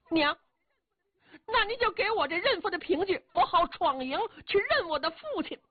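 put-on high boyish voice, eager and insistent; a young son begging for the token; pleading boy. Son asking his mother for the token, high and eager.

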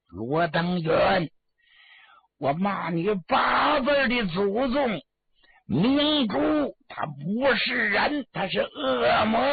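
high, drawn-out dramatic curse; acted defiant outcry; enraged cursing. Cornered man curses his killers.

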